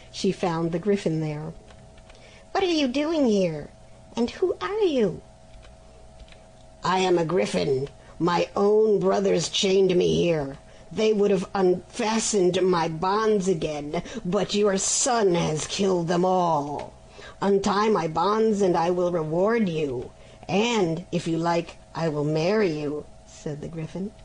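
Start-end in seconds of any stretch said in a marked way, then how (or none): 2.5-5.2 higher, startled mother discovering the griffin; a curious mother, voice lifted higher; mother's voice. Acted. Mother startled to find a chained griffin, higher voice. 6.8-23.0 lightly voiced griffin, coaxing and sly; a hint of a scheming beast, wheedling. Chained griffin coaxing the mother to free him, sly voice.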